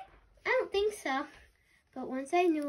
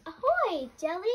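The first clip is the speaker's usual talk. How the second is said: excited greeting; 6 semitones higher, 5 dB louder than usual. Acted. Narwhal character greets Jelly.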